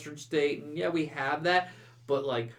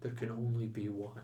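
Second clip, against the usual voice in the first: dramatic movie whisper; 2 semitones lower, 9 dB quieter than usual. Acted. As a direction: breathe it like a movie tagline. Quotes Highlander line.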